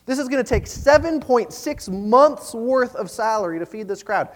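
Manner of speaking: high, reasoning protest with punched figures; incredulous protest. Acted. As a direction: question the expense in acted disbelief. Disciples protesting the cost to Jesus.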